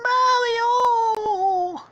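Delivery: long, loud falsetto scream; Princess Peach. Acted. Princess seeing her suitor shot, anguished falsetto scream. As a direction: scream the name out in hammed-up horror. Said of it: "Princess Peach is like".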